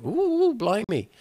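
high, slow drawn-out "Ooh, blimey"; delighted surprise. Real, not acted. Player reacting to the love scene, gleeful surprise. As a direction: savour the shock.

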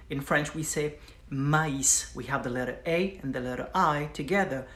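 ordinary talk

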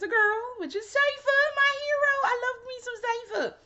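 very high, excited squeal of adoration; gushing fangirl squeal. Real, not acted. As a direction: squeal with delight over your favorite hero. Gushing over a favourite character.

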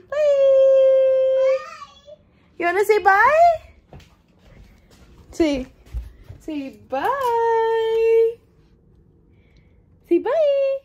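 high, loud sing-song bye. Real, not acted. Coaxing baby to wave.

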